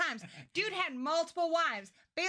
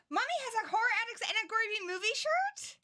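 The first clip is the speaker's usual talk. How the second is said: put-on high, gasping little child; excited kid voice; 10 semitones higher than usual. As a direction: squeal like a thrilled toddler. Voicing a kid reading a shirt.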